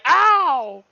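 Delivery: high loud yelp of pain; mock pained yelp. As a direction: yelp it like a hit player. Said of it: "the guy with the helmet was like".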